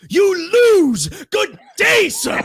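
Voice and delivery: very high, loud theatrical shout; Willy Wonka. Retold or imitated. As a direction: imitate an outraged dismissal at full pitch. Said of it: "he does the whole Willy Wonka bit".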